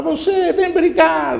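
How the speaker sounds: very high, loud dare; shouted challenge. Acted. Angry boy dares the hidden voice, shouting. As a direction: holler it like a boy picking a fight. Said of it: "ele chama pra brigar".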